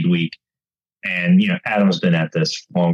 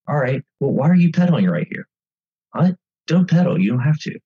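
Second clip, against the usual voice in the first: animated coaching; 7 semitones higher than usual. Retold or imitated. Adam coaching him mid-race.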